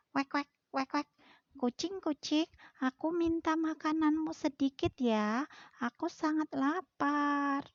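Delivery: high quacking duck begging for food; quack, then plead high and small. Acted. Duck begging the cat for food, high pleading quack. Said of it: "ini bebeknya minta makanan pada kucing".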